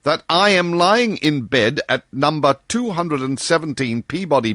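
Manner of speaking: high, emphatic lightly voiced doctor; high, precise voice of Bull defending ordinary men; Bull, precise and stubborn. A narrator's reading. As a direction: hammer home each denial. Bull's long speech, voiced.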